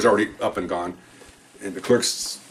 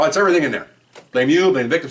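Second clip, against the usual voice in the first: higher, louder sarcastic rundown; mock lawyer pitch; 6 semitones higher, 5 dB louder, faster than usual. Cop mocks defense lawyers' playbook, sarcastic glee.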